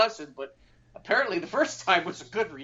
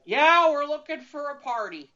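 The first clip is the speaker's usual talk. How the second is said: put-on higher, louder drunken blurt; drunk friend blurting; 10 semitones higher, 6 dB louder than usual. Drunk friend in back seat blurts to the officer, loud and high. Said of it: "is like".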